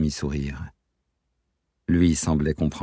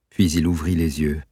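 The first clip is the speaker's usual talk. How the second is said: plain reading voice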